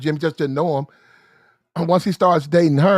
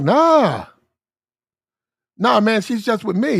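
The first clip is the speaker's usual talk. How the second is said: dismissive denial; 5 semitones higher, slower than usual. Retold or imitated. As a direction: wave the idea away, sure of himself. Boyfriend denies she cheats.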